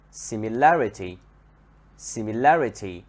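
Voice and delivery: "similarity" with LAR stressed, then falling; stress in the middle. Shown as a demonstration. Teacher shows adjective turned noun, LAR in "similarity" stressed. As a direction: lean on LAR in "similarity": simi-LAR-ity. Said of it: "on the second part in the middle".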